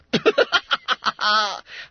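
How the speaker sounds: high, loud ha-ha-ha; mocking laughter. Schoolboys laughing at the trapped boy, mocking. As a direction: laugh heartily at his predicament.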